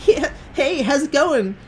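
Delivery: put-on very high girly falsetto; mocked gamer girl. Girl answering hello in team chat, high mock girly voice. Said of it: "she's like".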